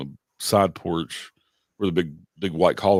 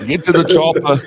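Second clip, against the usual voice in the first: loud, high mock Austrian yell; Arnold Schwarzenegger; 10 semitones higher, 11 dB louder than usual. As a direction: bellow it in a hammed-up Austrian accent. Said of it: "Was it Arnold Schwarzenegger?".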